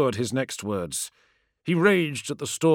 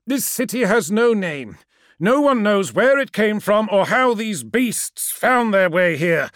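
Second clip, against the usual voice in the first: raised, higher, pressing voice; desperate, emphatic explanation; urgent insistence; 8 semitones higher, 6 dB louder than usual. Acted. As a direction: call out the truth urgently. Ammon insists against Magnus.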